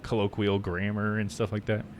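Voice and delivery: usual speaking voice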